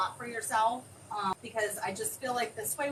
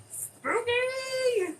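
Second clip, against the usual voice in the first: very high, drawn-out squeal; playful spooky cry; 13 semitones higher, 4 dB louder than usual. Pretend. Host hyping the spooky design, playful high cry. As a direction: sing it out high and long.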